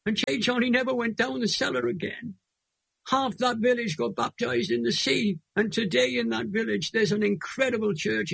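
fighting back real tears as he speaks; choked up with tears. Real, not acted. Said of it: "I'm gonna cry now".

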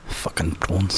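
low mock South African accent; low, softened South African imitation. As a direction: drop deep into put-on South African. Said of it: "South African accent".

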